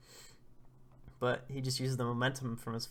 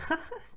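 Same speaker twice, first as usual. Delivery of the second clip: a high, bright tickled giggle; girl laughing at a tickle. Retold or imitated. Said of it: "She goes".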